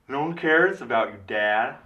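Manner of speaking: high, loud yell pushed through a covered mouth; imitating Emma Stone's yell. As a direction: yell it with the teeth hidden behind the lips. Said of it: "So that's how she yells".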